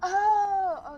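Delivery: high, loud "oh" of understanding; sudden realization. Real, not acted. Friend finally getting the saying, dawning realization.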